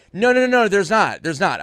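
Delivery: high, loud, fading to calmer; frantic denial. Retold or imitated. Teller stopping the panic, loud frantic denial. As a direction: wave them off urgently.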